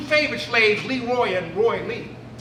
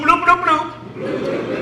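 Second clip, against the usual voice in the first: slow bloops; 5 semitones higher, much slower than usual. Shown as a demonstration. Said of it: "Now go bloop".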